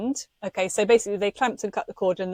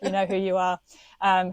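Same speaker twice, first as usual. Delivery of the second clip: ordinary talk, nothing marked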